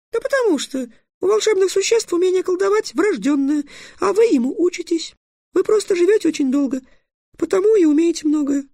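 very high squeaky tirade; scolding fairy. Acted. Fairy listing magical beings to the dragon, furiously scolding. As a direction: rattle off indignantly in a tiny piping voice.

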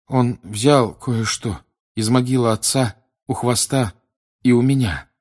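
shaken. Acted. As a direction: force out each painful item. Shaken boy answers haltingly. (с трудом, прерывисто и взволнованно)

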